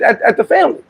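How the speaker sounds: ordinary talk, nothing marked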